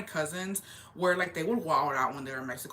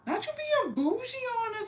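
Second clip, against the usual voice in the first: very high-pitched teasing call-out; mock disbelief. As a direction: squeal it in mocking disbelief. Mocks aunt exposed as not bougie.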